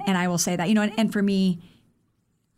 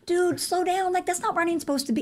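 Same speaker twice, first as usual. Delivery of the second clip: exasperated; 11 semitones higher than usual. Retold or imitated. Scolding runners going too hard.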